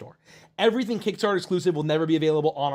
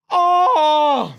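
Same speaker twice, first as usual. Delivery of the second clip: high, loud drawn-out "oh"; hammed-up goofy wail; mock wail; 11 semitones higher, 10 dB louder than usual. Character dreads people giving grief, mock wail. Comic wail about getting criticism.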